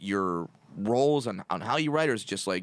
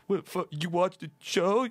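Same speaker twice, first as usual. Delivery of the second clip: surprised; 7 semitones higher than usual. Retold or imitated. Acted surprised reaction.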